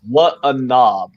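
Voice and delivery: slow, drawn-out mock English accent put-down. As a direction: say it like a posh Brit, stretched out. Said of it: "in an English accent".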